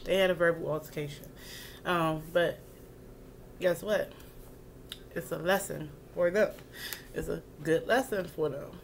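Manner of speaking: usual speaking voice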